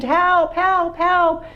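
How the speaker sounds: shouting help, stuck in the ravine; yelling for help. Acted. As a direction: call out desperately from the ravine. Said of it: "He yelled and he screamed".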